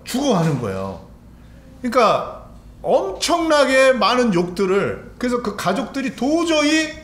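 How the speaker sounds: high, impassioned sermon voice; fervent preaching. Acted. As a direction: press each phrase home with urgency. Preacher builds intensity.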